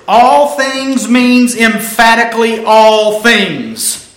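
slow, emphatic, stretched "All things"; loud and clear. Real, not acted. Preacher insisting on the Greek emphasis, slow, loud and clear. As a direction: drive home "all things" with drawn-out force. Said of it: "meant to be loud and clear".

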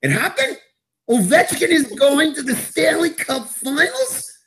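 high-pitched, squealing disbelief; incredulous amazement. Acted. Fan stunned Ovechkin reached the finals, incredulous. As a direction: voice shooting way up in astonishment.

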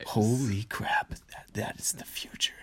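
hushed, breathless whisper of awe; awed whisper. Real, not acted. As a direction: whisper it like a grand revelation. Awed reaction to podcast slogan idea.

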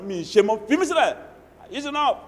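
mock pleading wife. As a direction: play the wife begging to be left alone. Wife pleading, voiced by pastor.

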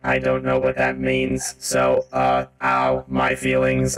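deep, slow sarcastic villain; deadpan fake pain; mock-hurt villain. The villain mocks the player's stoat insult with deep sarcasm.

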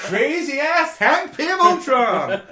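loud, high, slow laughing shout; laughing cheer. Real, not acted. Fan cheering the merged villain, laughing and loud. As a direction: whoop it out, laughing.

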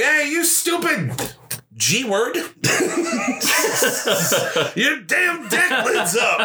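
high, slow mock yell through laughter; laughing impression of a cranky grandpa. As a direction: imitate grandpa griping about the mower deck. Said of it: "be like".

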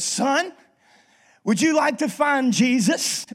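preacher's offer. Retold or imitated. Preacher voiced in joke.

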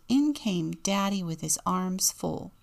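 ordinary delivery, nothing marked

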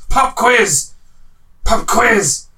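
high, loud hammed-up villain shout; over-the-top villain. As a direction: overdo the villain's taunt. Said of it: "I'm giving it too much".

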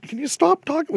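put-on very high, meek little-kid voice; tiny kid. Small boy in the cinema pleading with loud talkers, very high and meek. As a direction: squeak it out like a powerless child. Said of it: "I was a tiny fucking kid".

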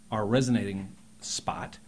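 ordinary delivery

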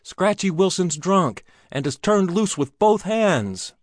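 high, loud alarm call, warning the room; urgent cry. Acted. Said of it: "He cried".

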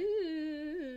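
high, robotic auto-tuned coo; mock auto-tune. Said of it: "auto-tune my voice".

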